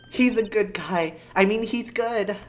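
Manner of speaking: put-on high-pitched girlish insistence; earnest Maya. Maya vouching for client to Nick, high and earnest. Voicing Maya changing her mind.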